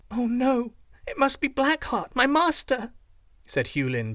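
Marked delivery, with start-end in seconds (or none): 0.1-2.9 put-on much higher girl's fearful cry; frightened, near tears. A narrator's reading. Slave girl hears her master banging, high frightened. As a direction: dread the master at the gate, high and scared. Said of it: "beginning to cry".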